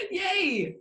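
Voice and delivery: giggly, high little yay; excited cheer. Real, not acted. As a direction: squeal it with joy. Guest cheers between laughs.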